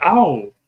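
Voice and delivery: mock ow yelp. Yelp of pain.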